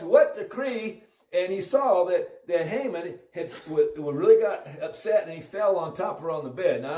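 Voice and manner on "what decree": high, loud, drawn-out "what decree"; startled retold question. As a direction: turn and demand it, stunned, as the king. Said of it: "He turned around and asked".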